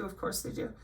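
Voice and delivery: a sarcastic breath of an aside; whispered aside. Real, not acted. Scoffing at the acronyms in a whispered aside.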